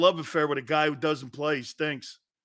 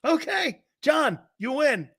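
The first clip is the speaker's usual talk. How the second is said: mock surrender; 8 semitones higher than usual. Real, not acted. Conceding to troll donor.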